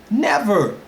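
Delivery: high, emphatic dismissal; emphatic denial. Real, not acted. Preacher rejecting the French tribe claim, emphatic. Answers own mocking rhetorical question.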